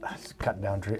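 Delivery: whispered aside. Real, not acted. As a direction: whisper it under the breath. Joke muttered under the breath.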